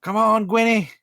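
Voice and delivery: loud, high cheer; excited shout; cheering the van on. Retold or imitated. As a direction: call out to the van like a fan.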